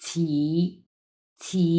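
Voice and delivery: "ছি" as in Bengali chhi-chhi, isolated; actual pronunciation. Shown as a demonstration. Teacher isolates the last syllable, clear aspirated demo. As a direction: say "ছি" alone with an aspirated chh. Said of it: "এটার actual উচ্চারণ হচ্ছে".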